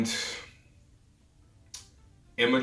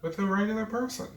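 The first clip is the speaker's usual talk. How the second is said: incredulous; 12 semitones higher, 3 dB quieter, faster than usual. Retold or imitated. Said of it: "why would you want a photo".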